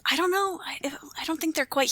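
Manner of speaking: hushed seance whisper. Acted. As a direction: whisper as if spirits listen.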